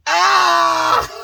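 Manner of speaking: loud high yelp; sudden cry. Real, not acted. Remembering a scene, a loud high yelp.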